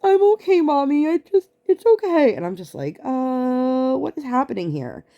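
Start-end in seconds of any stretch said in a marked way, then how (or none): 0.0-2.3 very high, louder tearful kid imitation; mock a child insisting through held-back tears; squeaky, upset little-kid voice; clearly not fine. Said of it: "she's very clearly not fine". 3.0-5.0 bewildered. Retold or imitated. Bewildered mother reacting to tearful child.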